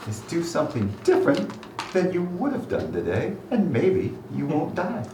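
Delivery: high, lilting trickster god voice; teasing deity with big swoops in pitch; playful god. Acted. God answers Chaser on surviving the day, playful and high.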